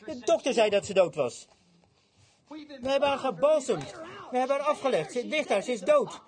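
high, slow, drawn-out booing; mock him like a jeering crowd; jeering scorn. Mourners boo and scorn Jesus, slow high jeering. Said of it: "ze lachten hem minachtend uit".

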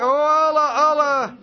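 high, loud wail to Allah; imitated cry. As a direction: call out high and loud like the overcome boy. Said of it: "riep hij". (nagedane hoge, luide uitroep)

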